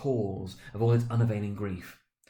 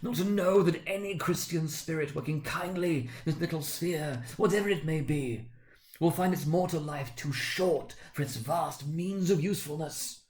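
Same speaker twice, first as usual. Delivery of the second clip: much higher, grieving spirit; impassioned ghost; 7 semitones higher than usual. Acted. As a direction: plead it like a damned soul preaching.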